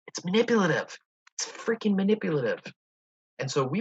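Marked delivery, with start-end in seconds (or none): 0.1-2.6 higher and louder, fired up; exasperated emphasis on manipulative; emphatic. Real, not acted. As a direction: really lean into the outrage. Emphatic outburst about voice.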